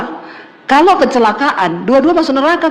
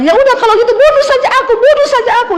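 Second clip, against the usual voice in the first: shrill retold shriek; 12 semitones higher, faster than usual. Preacher voicing a wife screaming at her husband during a fight, high hysterical yell. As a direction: fling it out like a desperate wife.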